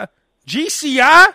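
unsure answer with a questioning lift; mock hesitant guess at the call letters; confused. Everyone else asked their station, confused questioning answer. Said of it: "it's always confusion".

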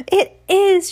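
thrilled discovery; excited. Acted. Sarah delighted the water is harmless, loud excited cry.

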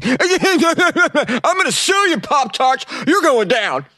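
very high, slow put-on woman's voice; shrill hammed-up plaintiff vowing to sue; mock Pop-Tart lady. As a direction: play the outraged lady suing Pop-Tarts, hammed up. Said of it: "a lady that was suing Pop-Tarts".

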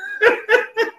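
very high-pitched burst of laughter; high staccato giggle; real laugh. Real, not acted. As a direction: laugh at the story you just told. Speaker laughs at the retold retreat.

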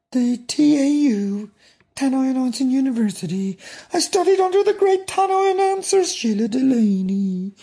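mock a store announcer, singsong high and stretched; tannoy announcer. Tannoy job candidate showing off announcements, exaggerated announcer voice. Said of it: "why do you have to talk like that?".

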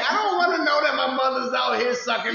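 high, loud disgusted laughing rant; shrieking with appalled laughter. Real, not acted. Ranting about sister's text.

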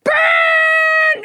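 high, hammed-up yell; mock fan yell. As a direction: bellow it from the stands. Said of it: "they're like".

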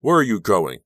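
called out loud and high; shouting after her. A narrator's reading. Robbie shouting after a girl leaving rehearsal, loud. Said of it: "Robbie shouted after me".

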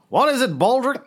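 mock haughty Blackadder reply; imitating Blackadder. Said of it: "Sire, I have a cunning plan".

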